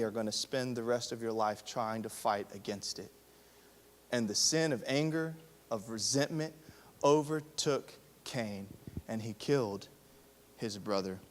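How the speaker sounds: low, soft, grave preaching; hushed sermon. Acted. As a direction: drop the voice to a solemn hush. Hushed solemn stretch of sermon.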